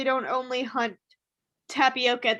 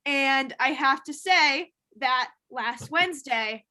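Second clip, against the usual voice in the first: usual speaking voice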